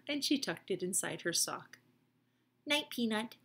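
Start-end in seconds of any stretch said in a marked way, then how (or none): none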